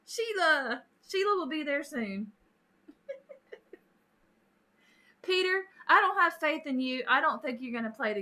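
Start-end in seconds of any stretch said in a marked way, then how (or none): 3.1-3.7 soft, high chuckle; quiet laugh. Real, not acted. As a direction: let out a small airy laugh. Laughs after teasing viewers.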